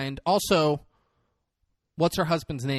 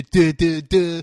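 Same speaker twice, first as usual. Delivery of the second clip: mocking, dopey stammer; mock a stutterer with dull syllables; making fun; 5 dB louder, slower than usual. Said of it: "making fun of himself".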